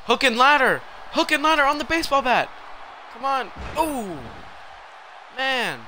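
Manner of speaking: high-pitched, thrilled play-by-play; excited move call. Real, not acted. Calls big move as it happens.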